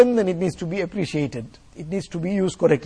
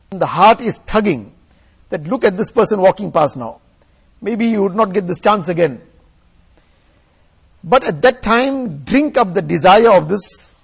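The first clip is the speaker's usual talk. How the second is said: preaching at full force; 6 dB louder than usual. Acted. Forceful sermon exhortation.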